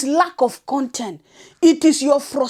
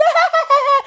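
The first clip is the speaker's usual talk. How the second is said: high mocking giggle; shrill mock laugh; 14 semitones higher than usual. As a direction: imitate her silly giggle. Mimicking her laugh.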